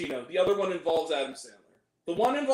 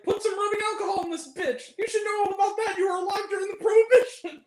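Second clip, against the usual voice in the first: high mocking voice of a movie character; quoted movie line; 13 semitones higher than usual. Friend quoting a funny movie insult, high mocking voice. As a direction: spit the insult out in a high put-on voice. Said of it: "there's some good lines".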